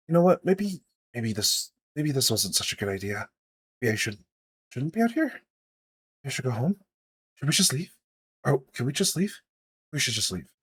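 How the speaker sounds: soft, hesitant nervous voice; anxious and hushed, wanting to leave; nervous character. Acted. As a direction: back out nervously, softly, with repeated false starts.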